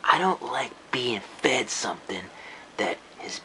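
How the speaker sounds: slow soft whisper. Real, not acted. Critic complaining about the film, slow whisper. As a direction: let disgust seep out slowly.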